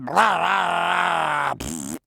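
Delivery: long sputtering "blah" ending in a pfft; sputtering engine noise. Acted. Character imitating the crashed magical bus, spluttering noise. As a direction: imitate a broken bus, drawn out and falling.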